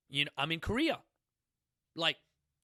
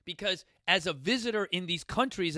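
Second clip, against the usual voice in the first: ordinary talk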